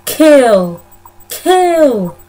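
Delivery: put-on flat, loud, eerie child chant; hammed-up creepy child voice saying kill; creepy ghost kids. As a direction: intone it like a little ghost child. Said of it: "this is the only thing they say".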